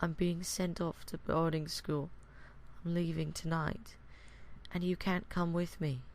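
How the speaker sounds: put-on soft, high, dejected child; quiet, downcast little voice; Isaac, teary-eyed. A narrator's reading. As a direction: say it small and hurt, close to tears. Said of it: "wipe away his tears".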